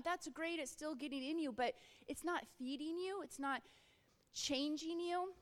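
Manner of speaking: ordinary delivery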